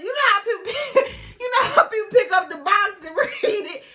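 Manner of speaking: high and loud, words broken by laughter; laughing. Real, not acted. Telling viewers how she reread the box, laughing hard. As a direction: laugh through it at your own silliness.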